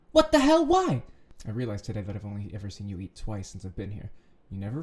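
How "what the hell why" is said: high, loud outburst of disbelief; loud exasperation. Real, not acted. Reader outraged at what the story reveals, loud exasperation. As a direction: cry out, appalled.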